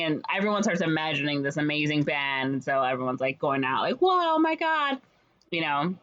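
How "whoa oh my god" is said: high, wowed exclamation; mock amazement. Townspeople leaving, dazzled by the band idea, mock amazement. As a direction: gush like an awestruck crowd.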